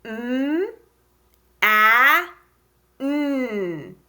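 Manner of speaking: "M, a, n" as separate sounds mmm, aaa, nnn; sounding out. Shown as a demonstration. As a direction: tap out "M, a, n" with pauses. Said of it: "Let's tap the sounds".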